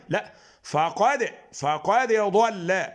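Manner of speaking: فقد ضل spelled out very slowly, each letter clear; careful slow correction; slow correct demo. Shown as a demonstration. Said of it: "ما تقولش".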